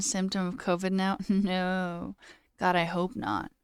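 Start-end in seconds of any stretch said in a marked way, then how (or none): none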